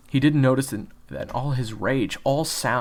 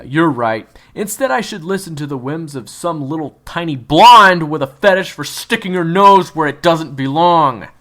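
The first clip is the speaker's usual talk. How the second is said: loud, high mocking sarcasm; sarcastic; 7 semitones higher, 15 dB louder than usual. Kyler mocking June's meddling, loud and sarcastic. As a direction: sneer it as fake agreement.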